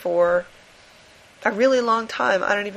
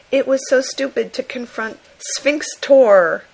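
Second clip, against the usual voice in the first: put-on higher, slower and louder, like an old comic panel; comic-book drama read; 4 semitones higher, 5 dB louder than usual. Reading a villain's comic caption, hammed-up. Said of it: "completely breathless style".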